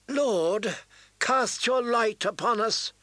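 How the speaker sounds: high prayerful call. A narrator's reading. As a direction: lift a prayer to the crowd. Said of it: "Melisande called out".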